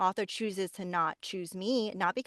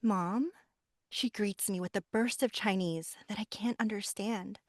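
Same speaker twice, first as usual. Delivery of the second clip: audiobook reading with character voices; story narration. Shown as a demonstration. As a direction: read it like an audiobook. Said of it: "so I'll just do a quick, very quick little blurb".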